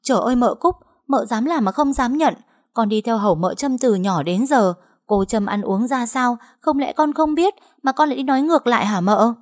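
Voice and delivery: high, pointed accusation; sly tattling. Acted. Maid Bích accusing mistress Cúc, sly and shrill. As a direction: needle the young mistress, all innocence. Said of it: "lẻo lự nói".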